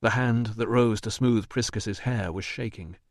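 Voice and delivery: usual speaking voice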